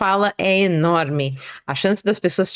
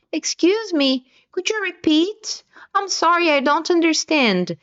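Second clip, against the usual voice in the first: higher-pitched polite request to repeat; puzzled listener; 8 semitones higher than usual. Acted. Listener asking the speaker to repeat, puzzled and higher-pitched. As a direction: act out someone who didn't catch it, pitched up. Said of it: "quando alguém disser".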